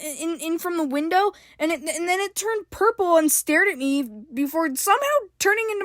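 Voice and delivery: high, rushed, stumbling scared explanation; frantic, frightened. A narrator's reading. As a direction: tumble out the story in panic. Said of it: "Evelyn explained frantically, trembling a little".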